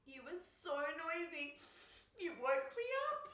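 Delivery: high, wailing complaint; crying bear. A narrator's reading. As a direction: wail it like an upset, woken bear. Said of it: "cried the bear".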